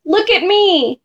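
high-pitched, showy call; mild shout. A narrator's reading. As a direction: call for attention without much volume. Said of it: "He twirled and shouted".

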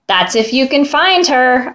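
ordinary delivery

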